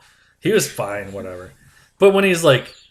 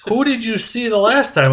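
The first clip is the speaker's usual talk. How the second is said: higher, a touch put-on doctor; 8 semitones higher than usual. Doctor quizzing the patient, higher and put-on. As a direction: ask like a puzzled clinician. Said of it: "He goes".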